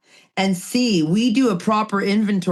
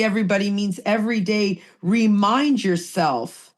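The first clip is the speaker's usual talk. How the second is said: usual speaking voice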